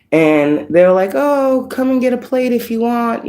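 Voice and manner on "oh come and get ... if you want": friendly host. Retold or imitated. Dream people offer her food.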